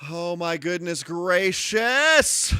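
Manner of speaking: drawn-out amazement. Real, not acted. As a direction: stretch every word in mock awe. Raid arrives on stream.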